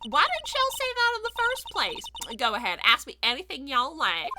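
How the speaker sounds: high, excited Southern twang; generic Southern accent. Acted. Photographer thrilled by the murder case, in an excited Southern accent. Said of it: "doing a very generic Southern accent".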